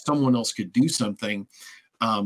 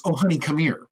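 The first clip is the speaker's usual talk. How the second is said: tender comfort; 4 semitones higher than usual. Retold or imitated. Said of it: "And she goes".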